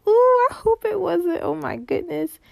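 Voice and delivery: a squeamish, high-pitched ooh; grossed out. Real, not acted. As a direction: recoil at the thought, voice jumping up. Grossed out imagining insides.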